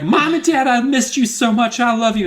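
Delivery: high, loud mock tearful love; overdone kid gushing. Boy tells parents he missed them, high and loud. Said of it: "he's like".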